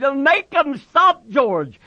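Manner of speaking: slow, high plea; frightened, drawn-out cry; crying out in terror. A narrator's reading. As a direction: wail to your protector for rescue. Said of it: "He cried".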